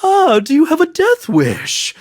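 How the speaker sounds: high mocking scoff. Interrogator mocking the lone survivor, high and sneering. Interrogator mocks defeated soldier.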